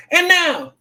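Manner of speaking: high, bright hype-man call; excited announcer. Acted. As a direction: announce it like a game show host. Calls drum roll, cheers crowd.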